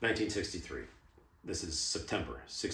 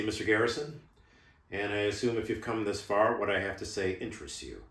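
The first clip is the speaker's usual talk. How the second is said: normal talking voice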